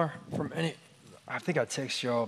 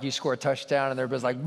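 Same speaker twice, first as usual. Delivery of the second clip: usual speaking voice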